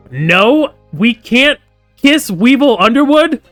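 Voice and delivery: loud, high, drawn-out disbelief; mock dismay. Gamer denied a joke romance option, mock-dismayed wail. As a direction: wail the question in mock outrage.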